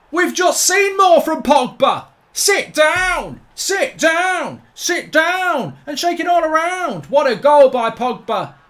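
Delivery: loud, high goal celebration; jubilant shouting. Real, not acted. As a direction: keep yelling in wild triumph.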